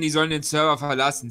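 normal talking voice

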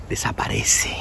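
whispered, fading away; vanishing whisper. Acted. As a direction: whisper it as if disappearing. Escenifica cómo el aprovechado desaparece. (susurro que se desvanece)

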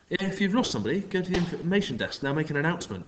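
a strange man feigning polite concern; smarmy stranger. A narrator's reading. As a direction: charm the kids with a fake smile. The man answers Kat.

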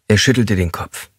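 usual speaking voice

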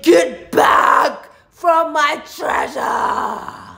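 huge, slow roar of a treasure guardian; menacing guardian. Acted. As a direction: bellow a drawn-out warning to intruders. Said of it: "something came and said".

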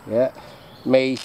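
normal talking voice

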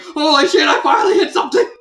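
high, fast, loud mock triumph; hammed-up astonished yell, laughing; amazed shout. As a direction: shout it in giddy disbelief. Church's amazed shout acted out.

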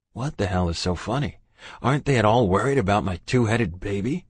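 ordinary delivery

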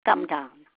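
ordinary delivery, nothing marked

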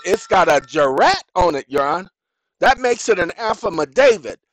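acted exasperated courtroom argument; insistent litigant. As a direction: hammer the point home to the judge. Acts insistent litigant arguing with judge.

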